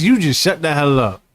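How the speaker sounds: normal talking voice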